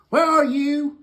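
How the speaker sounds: loud high calling question; God searching for Adam and Eve. Acted. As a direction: shout it into the garden. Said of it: "here comes God ... and he says".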